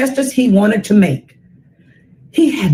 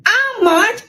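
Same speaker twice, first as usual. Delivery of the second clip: little brother; 10 semitones higher than usual. Acted. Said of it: "My little brother said".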